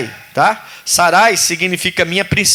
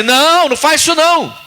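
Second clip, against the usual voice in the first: raised, pleading voice of God; a lightly raised mock voice of God; God's voice; 7 semitones higher than usual. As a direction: voice God kindly forbidding. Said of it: "Deus não fala assim".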